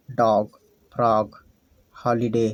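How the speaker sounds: normal talking voice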